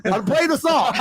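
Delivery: laughing through the line. Real, not acted. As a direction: chuckle while saying it. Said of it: "repeated with laugh".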